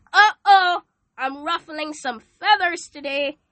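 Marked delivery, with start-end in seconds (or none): 0.1-0.8 Uh-oh cried out high and loud. Real, not acted. Preacher ruffling listeners' feathers, loud high uh-oh. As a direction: belt Uh-oh with a laughing alarm. Sensing she offends listeners.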